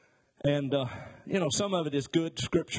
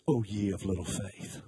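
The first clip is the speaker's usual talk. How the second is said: condescending; 7 semitones lower than usual. Retold or imitated. Jesus woken from a nap, chiding the disciples condescendingly. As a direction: talk down to them like an irritated teacher. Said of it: "you can hear the condescension".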